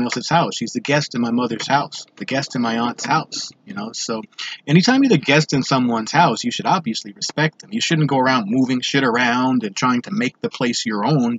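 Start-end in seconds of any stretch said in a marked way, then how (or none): none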